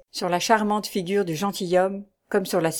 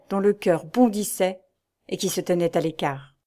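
plain reading voice

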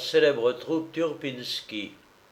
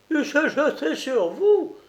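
much higher, rising indignant cry; outraged disbelief; 13 semitones higher than usual. A narrator's reading. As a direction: shriek it back in disbelief. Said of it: "clama la grosse colombine".

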